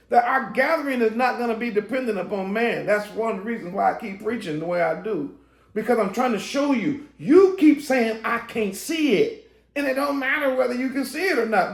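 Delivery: high, urgent preacher's voice. Acted. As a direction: press the point home to the congregation. Preaching again after aside.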